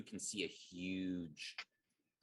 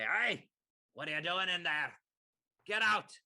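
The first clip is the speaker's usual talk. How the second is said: high, loud challenge from Duergar guards; shouted demand, pitched way up; angry yelling; 10 semitones higher, 7 dB louder than usual. Acted. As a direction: yell at the intruder to clear off. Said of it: "as they yell at me".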